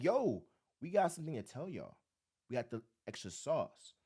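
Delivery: a hint of a low, confiding coach; coach talking. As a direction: lean in low like sharing a secret. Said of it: "hit up Nick Nurse and be like".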